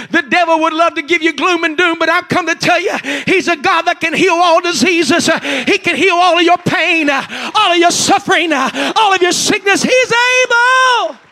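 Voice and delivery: loud, fervent pulpit preaching; preaching at full force. Acted. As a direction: preach it, climbing to a shout.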